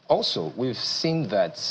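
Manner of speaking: ordinary talk, nothing marked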